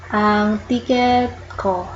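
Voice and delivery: ordinary talk, nothing marked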